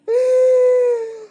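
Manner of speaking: very high squealing laugh; real high-pitched laughing fit; helpless laughter. Real, not acted. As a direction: laugh till it turns into a squeal. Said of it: "laughing in tears".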